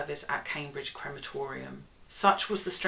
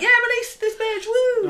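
high rowdy mock cheer; mock cheering crowd; 12 dB louder than usual. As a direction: whoop it up like a rowdy fan. Mocking cheering supporters outside.

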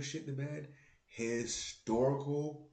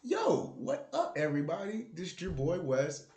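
higher, punchier show opener; hyped intro; 6 semitones higher, 4 dB louder than usual. Shown as a demonstration. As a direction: pump up the greeting, host energy. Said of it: "Let me start it over".

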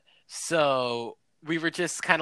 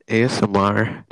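loud mock ASMR; 4 semitones lower, 6 dB louder than usual. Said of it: "How loud can I get?".

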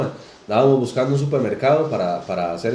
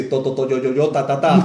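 rapid-fire repeated syllables; imitated stutter. Retelling a meme about a stuttering car painter, mock stutter. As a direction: mimic a stammer, each syllable knocked out repeatedly. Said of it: "es tartamudo".

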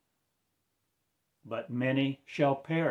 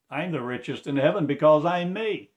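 loud, raised boast of glory; fervent preaching; 6 semitones higher, 9 dB louder than usual. Acted. Preacher boasting of heavenly riches, loud and proud.

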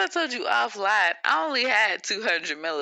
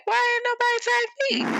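much higher, put-on voice; mock whiny question. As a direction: whine it like nobody noticed you. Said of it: "I'm like".

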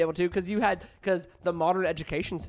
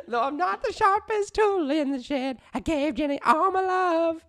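slow, higher Southern drawl as Forrest Gump; 10 semitones higher, slower than usual. Pretend. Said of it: "Let me try it with another feel".

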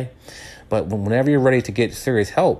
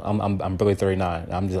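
ordinary delivery

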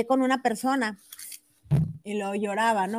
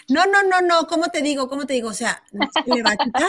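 friend's voice, louder and higher; insistent friend; 4 semitones higher, 6 dB louder than usual. Retold or imitated. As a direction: push the questions up, exasperated and raised.

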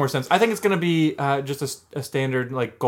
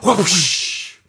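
whoosh sound effect; 5 semitones higher, 4 dB louder than usual. Pretend. Imitated handshake noise.

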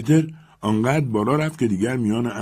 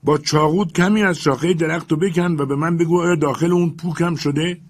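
higher, louder call up to the servant; shouting up; 9 semitones higher, 5 dB louder than usual. Acted. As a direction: raise the voice and call up the tree. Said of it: "فریاد زد".

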